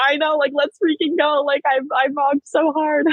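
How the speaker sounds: high-pitched excited agreement; retold hype. Retold hyped reply to friend.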